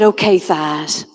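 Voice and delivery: slow, low, deliberate command; mock pep talk. Speaking to her own thighs before marching, slow and low. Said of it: "I said".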